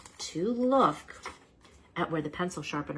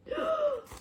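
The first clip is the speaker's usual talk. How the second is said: sharp gasp. Acted. Sudden realization about the drawing.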